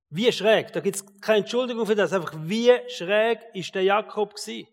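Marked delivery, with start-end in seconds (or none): none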